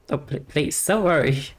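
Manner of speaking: ordinary delivery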